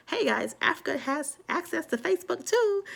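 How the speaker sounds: very high-pitched put-on voice; mock announcement. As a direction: announce it in a silly high squeal.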